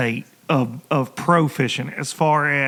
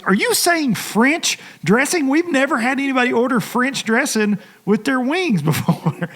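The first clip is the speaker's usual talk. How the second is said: puzzled waitress; 8 semitones higher than usual. Retold or imitated. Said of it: "She goes".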